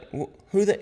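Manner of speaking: high, stumbling start to a question; retold bafflement. Buddy asking about an overheard name, baffled. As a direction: imitate a baffled, incredulous buddy.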